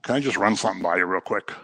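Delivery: low, soft, quick and casual; mock polite request. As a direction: imitate a timid, offhand interruption. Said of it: "She doesn't say ... She cries out".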